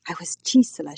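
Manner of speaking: understated lingering anger; fuming. A narrator's reading. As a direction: hold on to a mild grudge. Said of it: "she said, still fuming".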